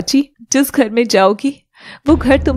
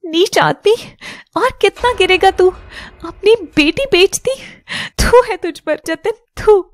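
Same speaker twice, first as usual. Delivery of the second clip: disgusted fury; 5 semitones higher than usual. Acted. Wife scorns husband who sold daughter.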